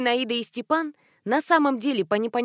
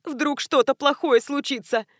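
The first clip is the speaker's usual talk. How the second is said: nasal whine; 5 semitones higher than usual. A narrator's reading. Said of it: "заныла Зина".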